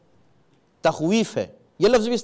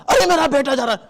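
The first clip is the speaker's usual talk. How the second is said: alarmed parent; 6 semitones higher, faster than usual. Shown as a demonstration. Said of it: "اب آپ کی کیفیت تو عجیب کیفیت ہے".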